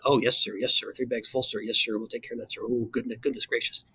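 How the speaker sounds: soft, meek yes sir; a hint of a fawning subordinate; mock groveling. Squadron commander to wing commander, lightly voiced groveling. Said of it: "Squadron commander is just doing the".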